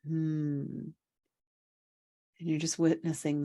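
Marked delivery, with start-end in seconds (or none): 0.1-0.9 long, thoughtful hum; pondering hum. Acted. Listeners integrating before they can know, pondering hum. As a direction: hum as if slowly absorbing it. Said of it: "You're in the hmm".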